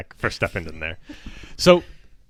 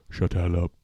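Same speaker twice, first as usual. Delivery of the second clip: Shaq voice; 4 semitones lower, 3 dB quieter than usual. Retold or imitated. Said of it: "This is Shaq".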